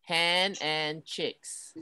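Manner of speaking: slow, careful word-by-word model; slow teaching voice. Shown as a demonstration. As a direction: spell it out slowly for a child. Teacher models words for children.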